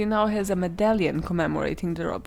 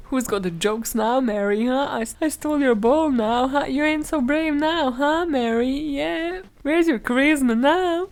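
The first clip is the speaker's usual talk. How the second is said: higher, louder mock southern bragging; taunting southern accent; 5 semitones higher, 4 dB louder than usual. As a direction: jeer like a child with a southern accent. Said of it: "better impression of a southern accent".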